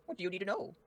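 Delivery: high falsetto worried wife; squeaky, fast high woman's voice; Miss Green. Acted.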